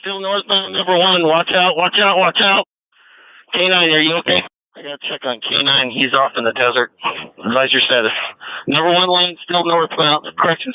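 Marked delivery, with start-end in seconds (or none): none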